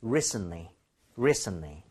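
"Recently" as RE-sn-lee, front-stressed; stress on first syllable. Shown as a demonstration. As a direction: lean on the RE of "Recently", no T. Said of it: "강세를 실어서 발음을 해주세요".

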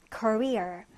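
Career with the stress on its last syllable, -REER; stress on second syllable. Shown as a demonstration. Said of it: "뒤듬절의 강세를 두고 읽어봅니다". (Career, 뒤 음절에 강세)

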